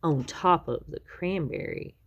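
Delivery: plain reading voice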